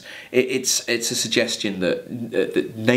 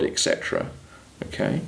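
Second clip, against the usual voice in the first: ordinary delivery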